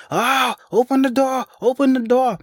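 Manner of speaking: high, pleading mock scream; retold scream. Trapped woman screaming high at the door to let her out. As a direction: cry out high and desperate to open the door.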